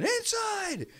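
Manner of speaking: very high-pitched retold scream; mock scream. Team staff screaming at broadcasters to get indoors, mock high scream. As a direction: imitate a scream, voice shooting way up. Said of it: "Redskins people are screaming".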